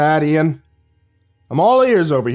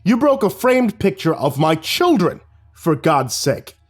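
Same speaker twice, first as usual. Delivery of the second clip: thundering; 6 semitones higher, slower than usual. Acted. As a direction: scold him, outraged. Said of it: "Mister Delvin thundered".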